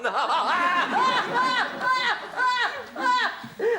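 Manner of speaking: long, high cackling laugh; raucous cackle. Acted. Host laughs at his own jibe, raucous cackle. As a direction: crow with high laughter at your own joke.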